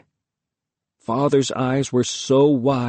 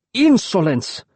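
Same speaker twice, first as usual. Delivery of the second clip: furious cry; angry outburst; 7 semitones higher, slower than usual. A narrator's reading. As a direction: roar it in rage. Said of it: "he cried".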